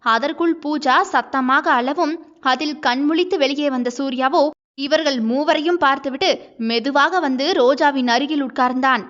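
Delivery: ordinary delivery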